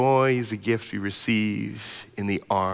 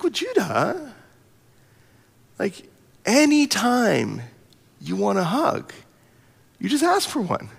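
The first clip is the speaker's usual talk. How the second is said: reassuring; 8 semitones higher than usual. Retold or imitated. Father reassuring crying son.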